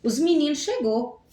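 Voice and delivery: teacher mimicking Goiás speakers, amused; Goiás speech. Shown as a demonstration. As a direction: imitate a local saying "Os meninos chegou". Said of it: "Como é que você fala isso?".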